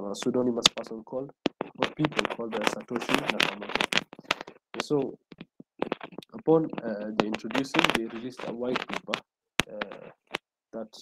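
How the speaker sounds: connection dropping out, voice fading; faint, far-off voice on a bad call; barely audible. Real, not acted. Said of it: "Your voice is very faint".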